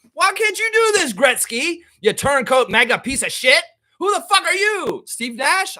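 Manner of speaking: loud, high, ranting outburst; loud, high rant of fake fury; mock outrage. Real, not acted. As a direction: tear into the turncoat stars. Berating Gretzky and Nash.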